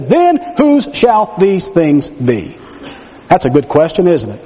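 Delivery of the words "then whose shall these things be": slow, weighty reading of the verse; slow scripture quote. Retold or imitated. Preacher reading scripture slowly to the church. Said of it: "It says".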